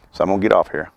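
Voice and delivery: normal talking voice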